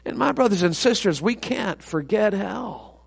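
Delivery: soft, grave plea to the congregation; hushed appeal. Real, not acted. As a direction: drop right down after the climax. Hushed appeal after loud climax.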